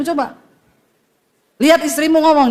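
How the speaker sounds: ordinary delivery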